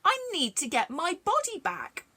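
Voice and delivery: high, loud protest; head refusing the miller, high and loud; Jack's head. A narrator's reading. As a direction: cry out as the head. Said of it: "The head said".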